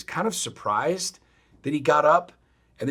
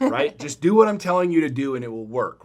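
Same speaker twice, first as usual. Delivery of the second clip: louder, higher insistent order; exasperated coach, voice raised; retold telling-off; 5 semitones higher, 8 dB louder than usual. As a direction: drive the instruction home forcefully. Retold telling-off of resistant kid.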